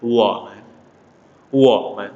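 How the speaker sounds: Woman with a light, unstressed last syllable; neutral tone. Shown as a demonstration. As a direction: let the end of Woman fall away lightly. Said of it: "nada netral".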